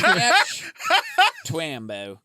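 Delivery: bad Australian accent, high and loud; shitty Australian accent. Shown as a demonstration. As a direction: ham up an Aussie accent. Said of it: "in an Australian accent or a shitty one like I just did".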